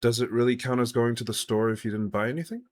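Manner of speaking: loud mock-logical comeback; incredulous retort. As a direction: throw the argument back, rising at the end.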